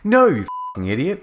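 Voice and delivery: high, angry mock shout; hammed-up furious retort. A narrator's reading. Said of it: "I shouted".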